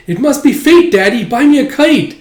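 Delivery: put-on high, loud begging kid voice; put-on child's excited plea; pleading child. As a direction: wheedle Daddy like an eager kid. Acted child begs father for kite.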